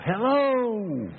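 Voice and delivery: high calling yell across the mountains; yelling hello. Retold or imitated. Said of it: "he would yell".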